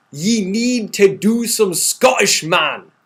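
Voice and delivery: loud, high, drawn-out mock Scottish; atrocious Scottish accent. Host reading a viewer's typed Scottish comment, atrocious Scottish accent. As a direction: slowly belt out a bad Scottish accent. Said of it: "in reading that accent, it was absolutely atrocious".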